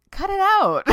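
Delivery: loud, high-pitched playful telling-off; mock scolding shout. Host telling listeners off for self-criticism, playfully shouted. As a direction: yell it in comic exasperation.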